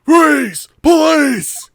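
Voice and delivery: high, loud shouted order; police shout. Acted. Officer arriving at the scene, shouting a command. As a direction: burst in yelling like a cop.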